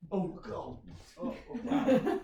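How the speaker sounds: surprised laugh. Real, not acted. Surprised at slide.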